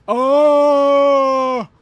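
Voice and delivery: loud, high gasp of joy; delighted gasp. Real, not acted. Streamer spotting her favourite character, loud gasp.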